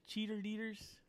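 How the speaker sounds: cheater leaders for cheerleaders; childish mispronunciation. Shown as a demonstration. Confessing an old mistake to friends, cheater leaders for cheerleaders. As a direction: say cheater leaders with an extra "ter". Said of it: "I used to say cheater leaders".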